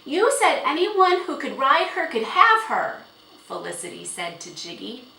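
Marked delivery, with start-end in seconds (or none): 0.0-3.0 high, loud accusation; indignant girl. A narrator's reading. Girl challenging the horse owner on his promise, indignant. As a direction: throw his words back at him, pitched high.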